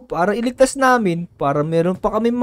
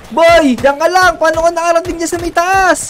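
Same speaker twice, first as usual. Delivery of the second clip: loud, higher-pitched call to a friend; raised, urgent calling voice; 4 semitones higher, 5 dB louder than usual. Acted. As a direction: shout up to someone out of reach.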